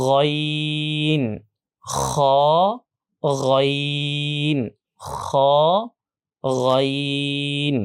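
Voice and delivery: long monotone গইন and scraped খ, both with rounded "o"; heavy throat letters. Shown as a demonstration. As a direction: model গইন and খ slowly from the throat. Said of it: "যেহেতু এটাও মোটা হরফ". (গলা থেকে টেনে মোটা গইন, খ)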